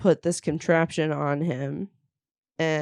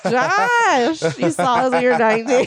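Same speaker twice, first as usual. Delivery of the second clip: laughing tease; 8 semitones higher, 5 dB louder than usual. Retold or imitated. Teasing friend about movie.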